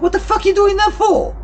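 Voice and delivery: very high, loud, incredulous outburst; outraged demand. Retold or imitated. Worker confronting those who binned his clothes, outraged demand. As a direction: re-enact confronting workmates, voice shooting right up. Retold angry confrontation at work.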